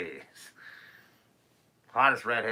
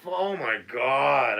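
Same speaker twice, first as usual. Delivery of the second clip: disbelief; 4 semitones higher, 9 dB louder, much slower than usual. Real, not acted. Disbelief at Turbo Teen.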